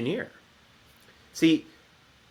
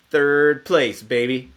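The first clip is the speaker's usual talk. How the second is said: loud, higher victory cheer; playful triumph; 3 semitones higher, 8 dB louder, slower than usual. Acted. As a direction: celebrate the win loudly, drawing out the first words. Boasting of a childhood medal win.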